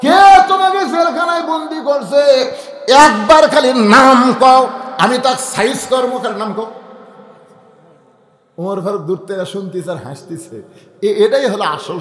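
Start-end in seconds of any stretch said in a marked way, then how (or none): 0.0-6.7 put-on high, loud boy demanding a name; boy shouting. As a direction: yell it like an angry little boy. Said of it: "ছেলে চিল্লায় বলে". (রাগে চিৎকার করা ছেলে)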